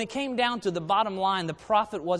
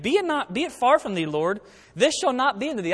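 higher-pitched, insistent scriptural protest; rebuking; 6 semitones higher, 4 dB louder than usual. Acted. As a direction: read Peter's rebuke in a raised pitch. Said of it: "began to rebuke him".

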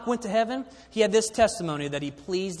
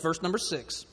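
ordinary talk

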